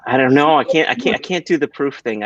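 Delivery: normal talking voice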